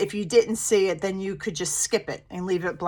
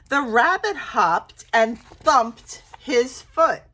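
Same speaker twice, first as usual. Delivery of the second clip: storybook read-aloud with animal voices; gentle picture-book reading; reading to children; slower than usual. Acted. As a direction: read it like story time for little kids.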